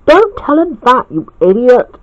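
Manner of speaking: high-starting, irritated scolding; mildly cross character voice. A narrator's reading. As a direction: snap at a foolish friend. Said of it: "said Jay crossly".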